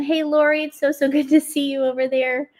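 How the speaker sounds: ordinary talk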